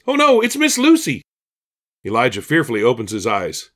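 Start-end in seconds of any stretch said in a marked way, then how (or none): 0.1-1.2 high, loud panicked exclamation; alarmed thought. A narrator's reading. Elijah thinks Miss Lucy has caught him, alarmed. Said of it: "Elijah thinks".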